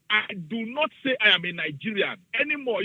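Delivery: ordinary talk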